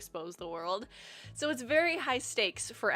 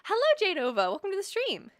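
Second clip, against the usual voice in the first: excited greeting; 11 semitones higher, 7 dB louder, faster than usual. Real, not acted. Greeting a chat viewer.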